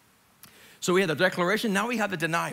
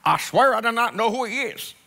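put-on Southern accent, emphatic oath; 3 semitones higher, 3 dB louder than usual. Peter swearing to bystanders, mock Southern accent. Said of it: "he's speaking in a southern accent".

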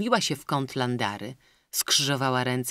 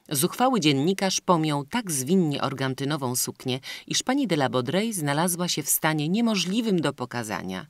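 ordinary delivery

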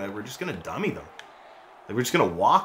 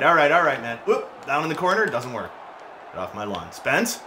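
ordinary talk

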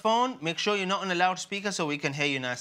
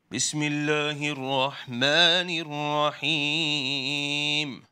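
Quran recitation; 4 semitones lower than usual. Acted. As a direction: chant each word with care. Teacher starts reading the surah.

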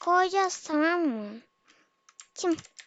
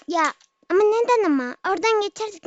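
louder, higher complaint; loud protest; 4 semitones higher, 8 dB louder, faster than usual. Real, not acted. Loud protest at players who deleted the way across. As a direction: demand to know why.

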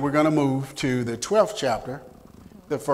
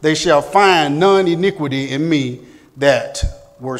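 louder, higher, proclaiming; preaching-style reading, louder and higher; scripture reading; 3 semitones higher, 8 dB louder than usual. Acted. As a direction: declaim Ephraim's boast with force. Reads scripture aloud.

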